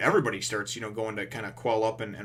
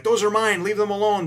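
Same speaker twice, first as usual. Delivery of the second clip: high, loud, furious protest; a girl yelling to protect her maps; a high, loud, indignant shout from a kid; angry child; 12 semitones higher, 10 dB louder than usual. Retold or imitated. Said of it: "And she's like".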